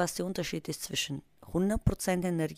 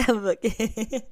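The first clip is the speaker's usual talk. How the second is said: laughing; 6 semitones higher, 6 dB louder than usual. Real, not acted. Laughs at her lost voice.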